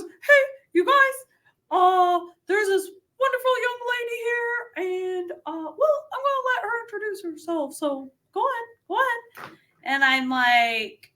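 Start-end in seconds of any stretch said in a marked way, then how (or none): 0.0-9.5 much higher, mimicking George's voice. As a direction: do George's high, odd greeting. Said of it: "He talks like that".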